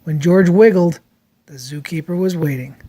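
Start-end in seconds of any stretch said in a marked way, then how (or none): none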